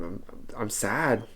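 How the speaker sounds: slow, quiet admission of real sadness; real sorrow, spoken softly and slowly; honestly sad. Real, not acted. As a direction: let the sadness come out slowly. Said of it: "I'll be very honest".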